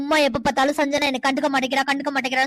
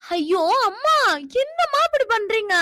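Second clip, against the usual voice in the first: exasperated whine; 7 semitones higher than usual. Acted. Girl whines at her mother.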